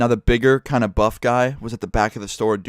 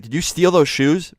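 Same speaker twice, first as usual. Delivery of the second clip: higher-pitched imitation of an accuser; retold accusing question, pitched up; mock accusation; 6 semitones higher than usual. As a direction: confront a caught shoplifter. Guard confronts the young thief.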